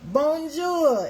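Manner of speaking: high, loud sing-song bonjour; cheery French greeting. Shown as a demonstration. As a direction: call out a bright hello to Charlene. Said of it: "French was mine".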